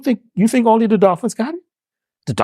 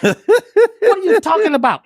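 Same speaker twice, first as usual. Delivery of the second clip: higher, louder, slow and laughing; mock disbelief; 12 semitones higher, 4 dB louder, slower than usual. Retelling the rapper story to the host, an incredulous laughing outburst.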